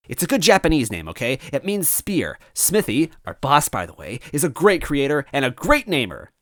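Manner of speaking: high, fast, offended henchman; huffy villain toad. Acted. Villain defending his odd name, high and huffy. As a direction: snap back defensively, puffed up.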